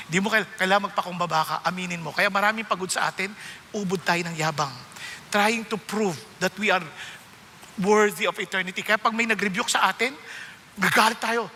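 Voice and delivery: ordinary delivery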